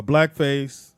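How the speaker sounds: ordinary talk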